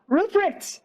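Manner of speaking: high, delighted call of his name; sudden happy cry across the room; surprised call. Acted. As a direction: call out to him, pleasantly surprised. Sister spots her brother.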